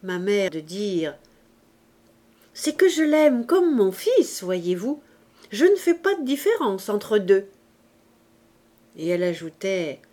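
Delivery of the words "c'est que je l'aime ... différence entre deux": a touch higher and louder, as the mother; lightly voiced mother. A narrator's reading. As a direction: lightly play a mother justifying herself aloud. (mère légèrement jouée, qui se justifie)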